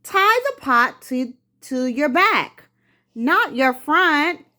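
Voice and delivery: put-on high, loud child's voice giving advice; calling advice. Son calling up the tree to his father with advice. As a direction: call it up the tree, eager to help.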